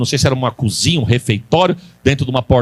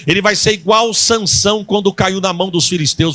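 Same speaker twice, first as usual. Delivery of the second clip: ordinary talk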